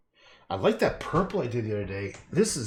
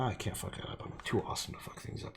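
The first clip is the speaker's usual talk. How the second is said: whispered boast; 9 dB quieter, faster than usual. Real, not acted. Mock self-praise.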